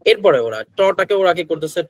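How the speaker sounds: usual speaking voice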